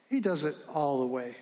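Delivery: ordinary delivery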